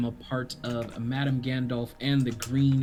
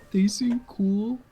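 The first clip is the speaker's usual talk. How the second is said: imitating gushing; 11 semitones higher, 7 dB louder than usual. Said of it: "I was like".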